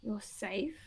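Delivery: calm, spaced-out reassurance; grounding reassurance. Acted. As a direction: soothe a panicked patient. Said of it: "just attempting to ground him".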